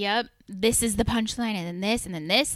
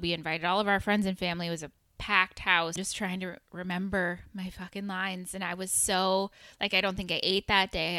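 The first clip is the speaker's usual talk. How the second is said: ordinary talk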